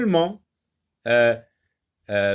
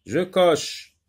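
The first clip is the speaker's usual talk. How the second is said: normal talking voice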